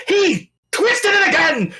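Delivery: high, loud outraged shout; NPC yells. Acted. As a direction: yell it out in indignant fury. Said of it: "I yelled".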